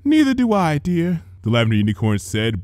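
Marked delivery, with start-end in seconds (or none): 0.0-1.2 lightly higher, refined big-sister voice; a hint of a fussy older sister; gentle big sister tending a sick sibling; Rarity's voice. A narrator's reading.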